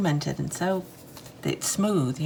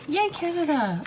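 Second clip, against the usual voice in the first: a bright little high cheer for Canada; spotting a Canadian label and cheering, pitched up high; excited cheer; 10 semitones higher than usual. Real, not acted.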